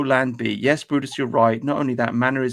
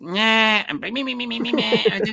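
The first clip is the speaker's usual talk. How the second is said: much higher, cartoonish mock voice; SpongeBob-style voice; 8 semitones higher than usual. Host mocking how Zoomer girls talk, high cartoon voice. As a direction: squeak it out like a cartoon character. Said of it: "listening to SpongeBob SquarePants".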